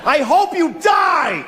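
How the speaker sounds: high, slow mock shout of a death wish; hammed-up road rage yell, drawn out. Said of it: "I said that to a person".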